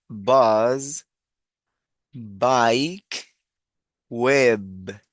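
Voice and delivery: "Bus, bike, web" one by one, B punched; drawn-out teacher's model of "Bus, bike, web"; stressed B sound. Shown as a demonstration. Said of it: "hacer la misma articulación".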